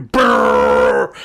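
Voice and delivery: long, slow, loud droning brrrr; imitated booming noise. Reviewer imitating the city scene's blaring noise, loud and slow. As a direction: rumble it out like a blaring soundtrack.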